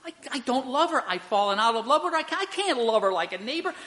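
high, rapid mock protest; retold indignant sputtering, pitched high; livid, acted out. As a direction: imitate an outraged husband, voice shooting up. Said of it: "he said he was livid".

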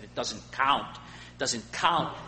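put-on light Scottish accent, slow on the repeat; a hint of a Scottish preacher's accent, drawn out. Preacher imitating a Scottish preacher's sermon, light Scottish accent. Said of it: "He had this Scottish accent, the way he said it".

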